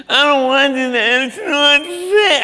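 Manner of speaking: put-on high, drawn-out whining kid voice; whiny child. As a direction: whine like a sulking child. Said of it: "How many of your kids ever complain?".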